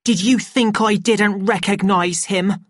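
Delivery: high, loud, indignant question; heated, high-pitched retort; angry. A narrator's reading. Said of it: "Linda asked angrily".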